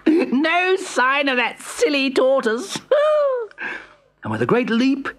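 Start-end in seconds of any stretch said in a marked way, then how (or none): 0.1-2.8 high gloating hare voice; gloat, sure of winning. Acted. 2.8-3.8 a very high gloating laugh; high cackle. Acted. Hare gloats.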